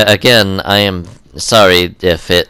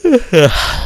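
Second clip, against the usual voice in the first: long high-pitched yawn; 11 semitones higher than usual. Real, not acted. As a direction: let out a big real yawn. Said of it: "I don't know why I'm yawning".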